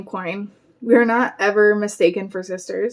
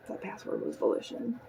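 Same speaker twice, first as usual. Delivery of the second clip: whisper; 16 dB quieter than usual. A narrator's reading. Narrator mouths the word silently.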